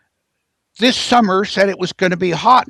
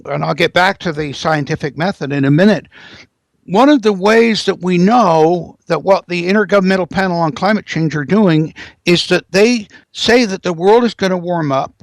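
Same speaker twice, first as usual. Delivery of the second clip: ordinary talk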